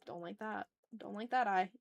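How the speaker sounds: ordinary delivery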